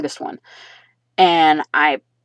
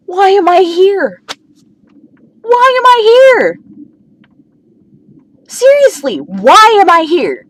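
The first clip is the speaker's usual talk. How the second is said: much higher, louder despairing cry; exasperated outcry; 13 semitones higher, 7 dB louder than usual. Real, not acted. Asking why she is even in this house, loud exasperation. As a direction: wail it up high in disbelief.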